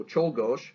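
Czolgosz said slowly as CHOLE-gosh; slow classroom demo of Czolgosz as CHOLE-gosh; pronunciation demo. Shown as a demonstration. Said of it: "just go".

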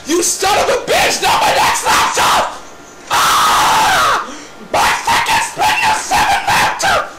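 high, ragged scream of outrage; enraged screaming. Pretend. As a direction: scream in fury over the ruined laptop. Screaming over wrecked laptop.

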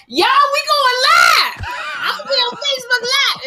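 very high, loud shouting half laughing, half crying; screamed excitement. Retold or imitated. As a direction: scream it out, laughing and sobbing at once. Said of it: "Nia Erica was like".

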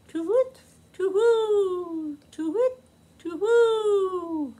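high, hooting owl call. Acted. The owl keeping Bug awake, high hooting. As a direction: hoot like an owl at the moon.

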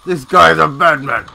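high, loud and excited; loud hype call. Real, not acted. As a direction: shout it out in delight. Repeats the line, first much louder.

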